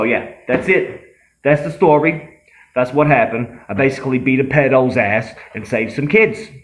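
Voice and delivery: a lightly voiced cocky tough guy, bragging; lightly voiced bragging tough guy, telling his tall tale; Terrell Polo. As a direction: swagger through the story like a self-styled hero. Comedian holds Terrell Polo character.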